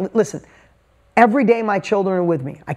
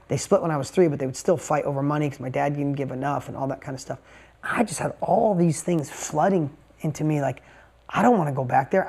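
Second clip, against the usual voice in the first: usual speaking voice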